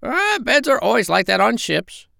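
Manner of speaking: very high, squawky parrot voice; squawking parrot voice. Acted. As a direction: squawk it out like a bossy old parrot. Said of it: "said the parrot".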